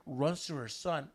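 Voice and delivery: ordinary talk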